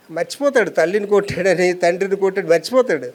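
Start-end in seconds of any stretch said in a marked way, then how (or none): none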